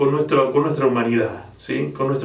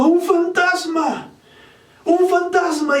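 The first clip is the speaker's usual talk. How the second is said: high, slow frightened cry; cry of fear; 14 semitones higher, slower than usual. Acted. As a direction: cry out as if you've seen a ghost. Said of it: "y dieron voces de miedo". (grito asustado, agudo y lento)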